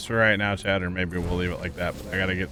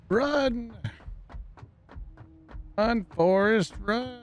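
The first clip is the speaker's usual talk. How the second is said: very high, drawn-out movie-quote cry; squeaky-high playful urging; high-pitched mock call; 13 semitones higher, much slower than usual.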